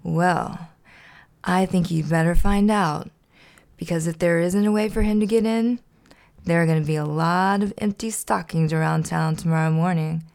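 ordinary delivery, nothing marked

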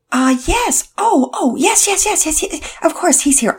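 an eager mother taking the news; Mama Peters on the phone. Acted.

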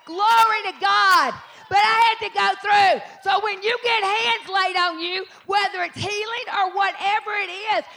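high, loud, triumphant proclamation; full-force preaching. Real, not acted. Preacher urging congregation to claim healing, impassioned shouting.

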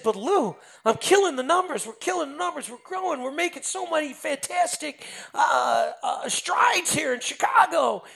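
high-pitched, excited self-defence; retold boast, pitched high with excitement; eager boasting. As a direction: reel off your wins in a high, eager voice. Retells his eager boasting to Lou.

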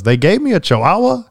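high-pitched incredulous punchline; mock-outraged surprise; shocked disbelief. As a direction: act astonished, pitch leaping way up into a question. Joke punchline, owner feigns shock.

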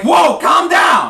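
high, loud, slow alarm; acted startled call to settle down; alarmed shout. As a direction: shout to restrain someone raging. Bartender startled by violent outburst.

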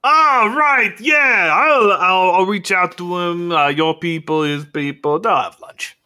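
high, loud character voice; Faust. Acted. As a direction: call it out high and loud, stumbling over the start. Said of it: "And Faust goes".

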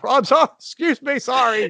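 put-on high, flustered apologies as kids skate past; mock-polite high apology while sprawled on the rink; sheepish apologizing. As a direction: squeak out embarrassed sorries. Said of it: "I'm apologizing for my injury to other people".

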